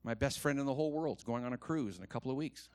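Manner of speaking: ordinary talk, nothing marked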